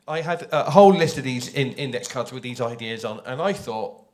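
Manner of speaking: ordinary talk, nothing marked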